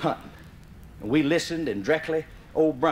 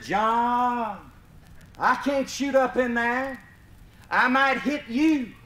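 calling up the tree; 6 semitones higher, slower than usual. Retold or imitated. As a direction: shout back up the tree. Mister Barron calling up.

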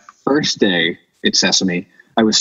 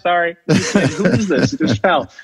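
laughing; 7 semitones higher than usual. Real, not acted. Laughing at own story.

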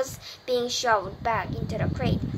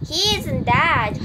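slow, louder insistent holler; defiant holler; 4 semitones higher, 7 dB louder, slower than usual. A narrator's reading. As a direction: holler it out to the crowd. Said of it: "hollered Zuckerman".

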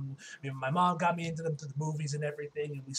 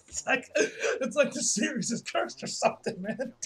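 talking through laughter, higher pitched; laughing; 6 semitones higher, slower than usual. Real, not acted. Reviewer joking that the franchise is cursed, laughing. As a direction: crack up while getting the words out.

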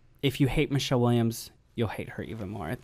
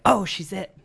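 higher, louder mock excitement; mimicked public hype; 7 semitones higher, 6 dB louder, slower than usual. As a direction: imitate everybody gushing over a new star. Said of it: "and everybody's like".

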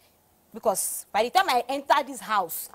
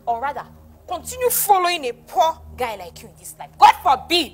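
high, loud, scornful refusal; forceful, high-pitched indignation; indignant outburst; 5 semitones higher, 6 dB louder than usual. Acted. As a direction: throw it out in outrage. Indignant refusal in an acted quarrel.

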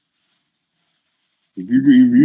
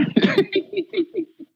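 high laugh. Real, not acted. Slips and laughs.

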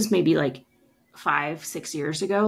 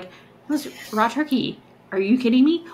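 incredulous disgust; 6 semitones higher, 3 dB louder than usual. Retold or imitated. Disgusted retort to partner.

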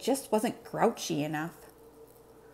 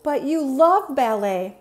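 high, slow, loud puzzlement; Elmo, puzzled; 6 semitones higher, 7 dB louder than usual. Acted. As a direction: protest slowly in puzzled little-monster voice. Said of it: "sounding puzzled".

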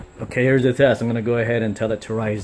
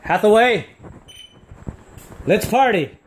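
excited command; 9 semitones higher, 3 dB louder than usual. Real, not acted. Commanding the party mode.

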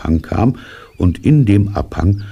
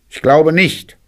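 high disguised rabbit voice; high, evasive little denial; rabbit character; 13 semitones higher, 5 dB louder than usual. A narrator's reading. Rabbit denies it is his voice, high rabbit voice. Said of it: "sagte Kaninchen".

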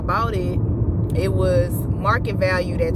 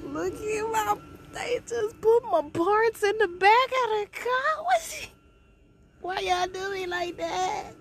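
real crying; 5 dB quieter than usual. Real, not acted. Viewing her wrecked car's parts.

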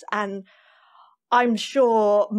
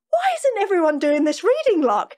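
delighted laughing exclamation; 11 semitones higher, 4 dB louder than usual. Retold or imitated. Retold delight after first day.

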